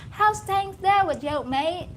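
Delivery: loud, high mock-Australian hail; Australian accent. Comedian imitating Australians greeting a mate, loud Australian accent. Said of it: "I heard a lot of Australians also saying".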